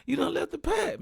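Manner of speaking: scolding told-you-so. Retold or imitated. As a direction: tell the robbed friend he left the pack. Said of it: "I say".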